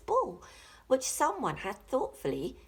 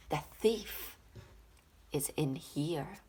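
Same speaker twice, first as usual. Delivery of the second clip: dog voice; 6 semitones lower, 4 dB quieter than usual. Acted. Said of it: "the bark meant".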